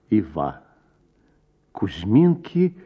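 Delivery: ordinary delivery, nothing marked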